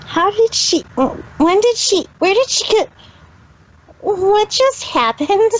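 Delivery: stumbling over each question in confusion; bewildered. Acted. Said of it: "Asked the extremely bewildered Trixie".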